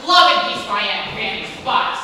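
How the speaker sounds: loud mocking scoff; scornful. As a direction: spit it back with contempt.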